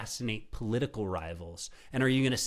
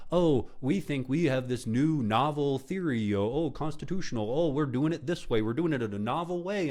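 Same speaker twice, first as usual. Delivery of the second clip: mocking excuse; 4 semitones higher than usual. Host parroting opponents' novel legal theory, mockingly. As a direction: mimic them with exaggerated innocence.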